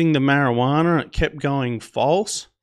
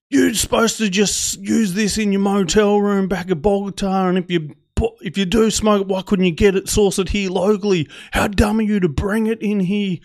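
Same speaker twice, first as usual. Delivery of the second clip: high, indignant telling-off; scolding officer; 7 semitones higher than usual. Retold or imitated. Officers berating a traveller over his marijuana, indignant. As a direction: lay into him, pitched up and exasperated.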